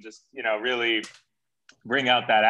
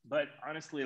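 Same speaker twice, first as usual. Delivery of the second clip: ordinary talk, nothing marked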